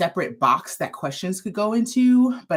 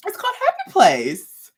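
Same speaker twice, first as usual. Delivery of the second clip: excited reveal; 11 semitones higher, 6 dB louder than usual. Real, not acted. Yarn line name, then repeated.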